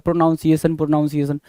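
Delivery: प्रोनाउंसिएशन with "noun" in the middle; common mispronunciation. Retold or imitated. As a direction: say प्रोनाउंसिएशन as "pro-NOUN-ciation". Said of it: "हर बच्चा ये बोलता है".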